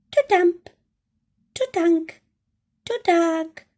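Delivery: tiny mouse fussing about the cave; squeaky mouse. Acted. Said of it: "Mouse squeaks".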